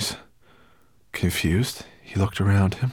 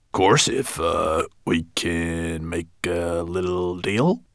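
sly dwarf; 2 semitones lower, slower than usual. A narrator's reading. Dwarf bargaining.

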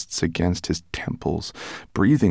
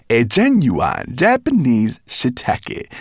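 slow, relishing each word; savouring pride; 5 semitones higher, 4 dB louder, slower than usual. A narrator's reading. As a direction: draw it out proudly, a little higher. Showing off a prized mushroom.

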